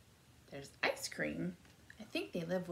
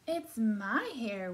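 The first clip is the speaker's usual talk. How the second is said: loud, slightly high, drawn-out zebra line with a light whinny; lightly whinny it, loud and stretched; 4 semitones higher, 8 dB louder than usual. A narrator's reading. Zebra answering whose hair it is, lightly whinnying. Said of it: "whinnied the zebra".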